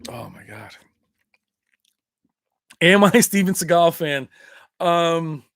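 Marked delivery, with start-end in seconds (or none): 0.1-0.8 whisper. Real, not acted. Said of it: "sudden whisper".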